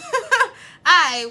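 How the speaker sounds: bursting into a loud laugh; a real laugh breaking through. Real, not acted. Laughing at own reaction.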